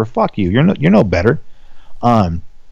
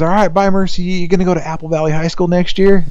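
put-on kid's voice; 7 semitones higher than usual.

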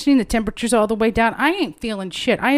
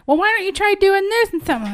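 imitating Patricia; 10 semitones higher than usual. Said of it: "here's, you know, Patricia".

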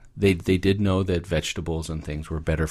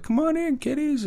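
put-on very high, sugary witch voice luring kids in; sweet grandma witch. Podcaster voicing the witch inviting the children inside in a very high sweet voice. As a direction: coo it like a fake-kind granny.

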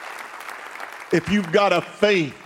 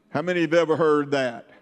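ordinary delivery

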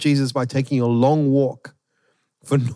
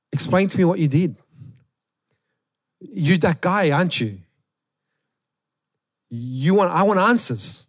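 demanding answers; 5 semitones higher than usual. Retold or imitated. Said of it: "I would say".

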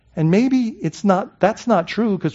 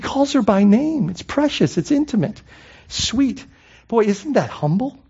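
normal talking voice

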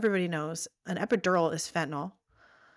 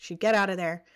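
normal talking voice

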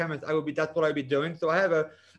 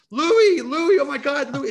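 high, loud desperate scream of a name; shrieking the name in terror; panicked screaming; 10 semitones higher, 9 dB louder, slower than usual. Retold or imitated. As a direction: scream for Louie as the car flies off. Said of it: "And I started screaming".

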